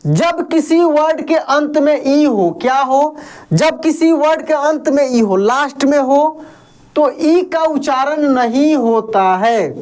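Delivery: normal talking voice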